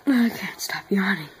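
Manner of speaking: breathy unvoiced whisper. Real, not acted. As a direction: whisper it tiredly. Whispered aside.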